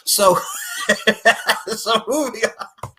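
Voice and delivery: words through laughter; giggling through the words; laughing. Real, not acted. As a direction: try to move on mid-laugh. Laughing while talking.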